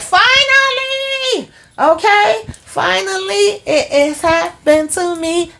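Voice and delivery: very high, slow, drawn-out shriek of joy; ecstatic shriek. Real, not acted. The vlogger reacting to Karen's pregnancy news, high ecstatic shriek. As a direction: cry out like it finally happened. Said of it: "And I'm like".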